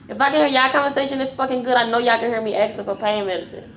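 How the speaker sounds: normal talking voice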